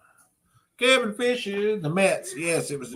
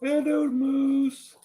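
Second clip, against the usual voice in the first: sing-song hello; 9 semitones higher, much slower than usual. Real, not acted. Modelling greeting for child.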